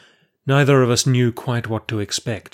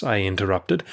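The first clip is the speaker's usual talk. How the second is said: plain reading voice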